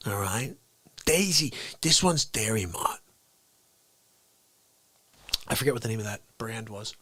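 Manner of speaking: usual speaking voice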